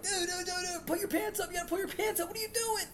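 very high, frantic pleading; panicked. Retold or imitated. Substitute begging a kindergartner to pull up his pants. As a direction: squeal in alarm at the pantsless kid. Panicked plea to pantless kid.

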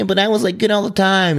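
high, whiny voice of the jealous brother; whiny older son. Retold or imitated. Older son whining to his father, high and whiny. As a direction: pout it out like a sulky sibling. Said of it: "the other son's like".

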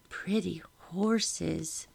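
soft, very slow character voice; slow, quiet little remark in Ruth's voice; young Ruth. A narrator's reading. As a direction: say it softly, drawing each word out. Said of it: "Ruth said".

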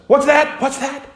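sudden high, eager double question; God perks up, keen to hear. Acted. God hearing a sinner repent, high and eager. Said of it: "God says".

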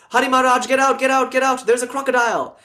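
high-pitched, acted alarm; urgent warning. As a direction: call out a danger to the swami. Someone warns swami of crocodile.

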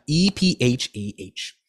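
"E P H A H" spelled letter by letter, slowly. Shown as a demonstration. Reader to listeners, "E P H A H" spelled slowly. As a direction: spell "E P H A H" one letter at a time. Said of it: "spells out the word".

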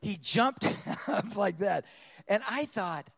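normal talking voice

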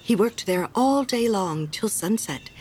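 plain reading voice

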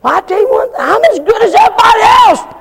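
high, loud boastful churchgoer; mock a self-satisfied believer; smug boaster. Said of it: "Now you say".

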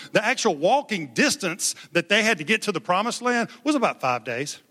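ordinary delivery

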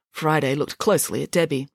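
ordinary delivery

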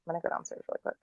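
quick whispered aside. Real, not acted. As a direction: whisper it away from the mic. Stepping away from the mic.

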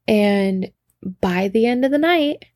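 ordinary delivery, nothing marked

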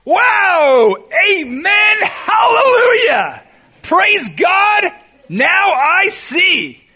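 loud, high, ringing shouts of praise, drawn out slowly; ecstatic joy. Retold or imitated. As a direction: shout Wow! Amen! Hallelujah! like a man overjoyed. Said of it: "Let me paraphrase".

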